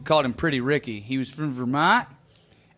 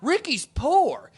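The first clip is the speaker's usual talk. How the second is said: mock realization; 9 semitones higher, 3 dB louder, slower than usual. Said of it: "we're like".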